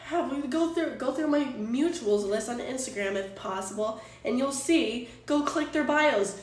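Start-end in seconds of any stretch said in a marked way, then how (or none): none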